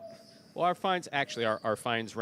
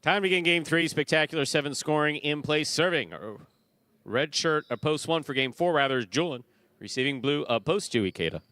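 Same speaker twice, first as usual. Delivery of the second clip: loud, higher-pitched live match call; play-by-play; 5 semitones higher, 4 dB louder than usual. Real, not acted. Announcer calling game three to the crowd, live play-by-play. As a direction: project each call like an arena announcer.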